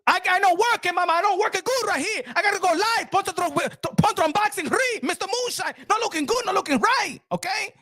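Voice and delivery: high, hammed-up complaining to the rep; mock complaint. Streamer retelling his call to the provider, mock complaint. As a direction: whine it high like an exasperated customer.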